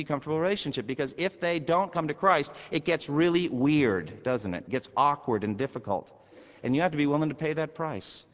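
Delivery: preacher rising to a charge; impassioned preaching. Acted. Preaching at full force.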